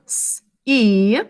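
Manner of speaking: ordinary talk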